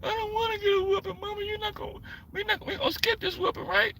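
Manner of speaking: high, soft hammed-up sobbing plea; mock crying kid. Kid sobbing, coaxing his mama to skip the whooping. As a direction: sob and coax mama to skip it. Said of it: "I'm like, 'Mama".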